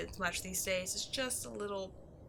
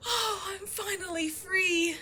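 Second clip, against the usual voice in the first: tearful relief; 8 semitones higher, slower than usual. Acted. Freed after a millennium.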